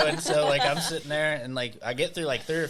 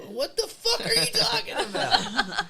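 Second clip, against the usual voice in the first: much higher, slow, laughing disbelief; incredulous; slower than usual. Retold or imitated. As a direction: squeak it out in disbelief. Retold baffled retort, laughing.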